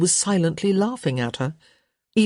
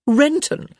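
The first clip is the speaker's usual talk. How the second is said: incredulous; 10 semitones higher, 4 dB louder than usual. Acted. Incredulous echo.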